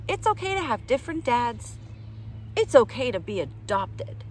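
lively storybook read-aloud voice; reading to children. Acted. Reading a picture book aloud to kids, bright and lively. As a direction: read it out brightly for little listeners.

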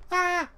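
loud, high shout; sudden high battle yell. Acted. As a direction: charge in yelling. Battle cry in game.

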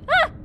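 short, loud startled yelp. Real, not acted. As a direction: yelp in sudden fright at the game. Startled yelp during game.

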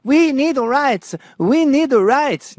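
high, chanting protest voice; mock protester. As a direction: chant it like a caricatured demonstrator. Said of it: "go to City Hall going".

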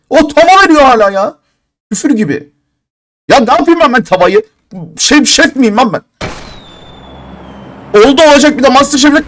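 high, loud fed-up outburst; furious ranting. Real, not acted. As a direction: yell at the game like it cheated you. Raging at crate rewards. (öfkeyle bağırarak söylenme)

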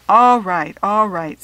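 loud giving in. A narrator's reading. Father gives in to pleading daughter, loud concession. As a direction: give in loudly, twice over.

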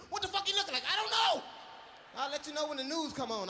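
high, loud yelling witness; mock shout. Witness yelling at the reporter, high mock shout. Acted quote of confused witness.

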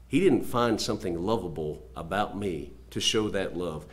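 ordinary delivery

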